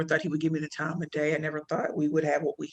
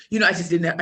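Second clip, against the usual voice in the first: ordinary delivery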